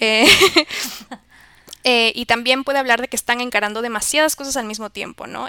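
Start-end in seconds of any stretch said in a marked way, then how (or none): 0.3-1.2 loud laugh. Real, not acted. Laughs at own joke.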